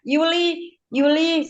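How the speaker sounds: a high, drawn-out call of a name from somewhere unseen; retold voice calling out. A voice calls a woman's name as she lies in surgery, high and drawn out. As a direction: call the name like a distant voice summoning her. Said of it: "dipanggil lagi Yuli yang buat yang kedua kalinya".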